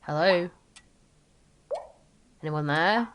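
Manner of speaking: ordinary talk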